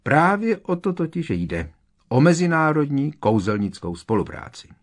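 self-important, high-pitched delivery; Percy. A narrator's reading. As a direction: pronounce it eagerly with great importance. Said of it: "tvářil se patřičně důležitě". (vysoký, důležitý tón)

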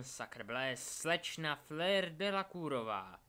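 showy commentator announcing the standings; tournament announcer. Acted. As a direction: proclaim it loudly to a crowd, drawing out the last name.

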